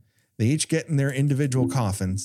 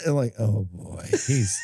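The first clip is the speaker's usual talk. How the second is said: ordinary delivery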